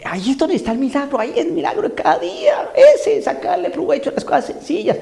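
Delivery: very high, put-on sappy voice; mock sentimental theologian. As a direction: gush in a high voice, parodying a modern theologian. Mimics modern theologians explaining away miracles.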